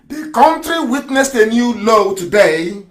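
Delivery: slow, deliberate reading aloud; reading very slowly. Shown as a demonstration. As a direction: spell it out slowly for slow listeners. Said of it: "I'll read very slowly so you understand it".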